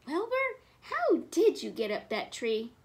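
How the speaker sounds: plain reading voice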